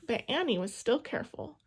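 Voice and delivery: usual speaking voice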